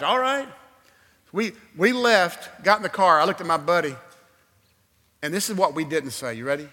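ordinary delivery

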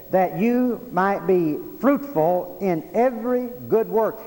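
slow, weighty stress on "every good work"; "fruitful in every good" spaced slowly and forcefully; emphatic verse quoting. Real, not acted. Preacher quotes the verse emphatically.

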